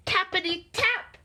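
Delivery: high, crisp knock sound words; sudden knocking. A narrator's reading. Narrator voicing the knock at Owl's door, high tapping.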